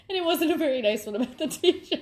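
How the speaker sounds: sheepishly giggling through the confession; giggly confession. Real, not acted. To viewers, admitting a mean note about the teacher, giggling.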